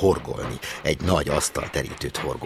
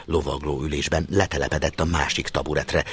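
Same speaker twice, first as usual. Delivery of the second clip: ordinary delivery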